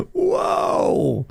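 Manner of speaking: high, drawn-out stunned exclamation; retold shock. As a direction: re-enact being taken aback. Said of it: "I was just shocked".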